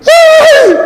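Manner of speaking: sudden high shriek of fright; mock scream. Shown as a demonstration. Preacher acting a scared scream. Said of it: "a gente grita".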